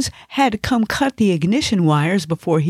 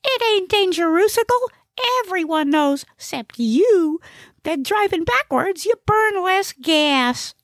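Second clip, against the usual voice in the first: high-pitched, cocksure character voice; very high comic know-it-all; know-it-all Gnesi. Acted. Gnesi dismisses Ig's worry about his driving, high cocksure voice.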